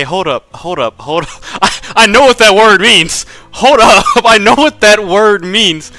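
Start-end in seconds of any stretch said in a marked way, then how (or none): none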